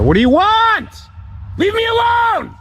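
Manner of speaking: very high panicked scream; frightened shout. Real, not acted. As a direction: scream them away. Camper screaming at intruders.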